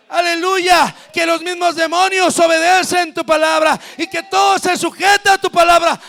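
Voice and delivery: shouted, fervent sermon climax; preaching at full force. Acted. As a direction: preach it loud to the congregation. Prédica a gritos. (predicación a gritos, con fervor)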